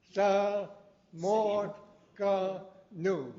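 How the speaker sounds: "Sa... Mo... Ka Nu", each syllable held apart; slow, syllable by syllable. Shown as a demonstration. Teacher showing the class the sound, slow syllable by syllable. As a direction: break "Sa Mo Ka Nu" into slow, separate syllables. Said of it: "you can say it slowly".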